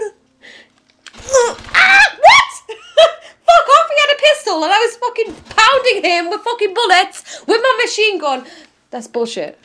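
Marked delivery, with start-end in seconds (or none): 1.7-6.7 high, loud laughing yell of outrage; furious laughter at the screen; real disbelieving shout. Real, not acted. Outgunned by a pistol.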